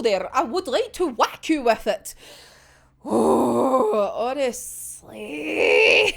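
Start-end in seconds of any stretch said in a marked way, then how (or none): 3.0-6.1 stretched, crying honestly; mock anguished wail. Real, not acted. Fan aching for Chuya, drawn-out crying wail. Heartbroken about the story.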